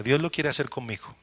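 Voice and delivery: hushed promise spoken over the congregation; quiet personal declaration. Pretend. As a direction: say it softly as your own prayer. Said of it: "su nombre, diga".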